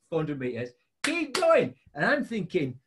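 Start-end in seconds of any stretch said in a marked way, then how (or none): 1.0-1.7 cheering. Retold or imitated. Spectators cheering him on.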